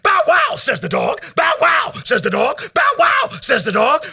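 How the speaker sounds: a hint of a yapping dog; mock barking. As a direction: yelp the bow wow up high. Dog voice mocked, shouted.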